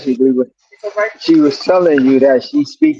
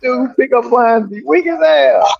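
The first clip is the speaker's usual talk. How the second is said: higher, slow mocking woman's voice; hammed-up dismissive lady, drawn out; mock sassy woman; 8 semitones higher, slower than usual. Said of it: "She was like".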